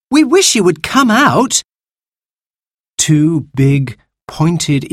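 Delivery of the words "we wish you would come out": put-on high-pitched, wishful child's voice; eager girl pleading. Sister coaxes hidden creature out, high eager plea. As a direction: coax hopefully in a high girl's voice.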